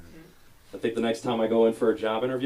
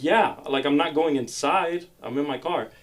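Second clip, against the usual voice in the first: exasperated; 6 semitones higher, 4 dB louder than usual. Retold or imitated. Arguing with drive-thru lady.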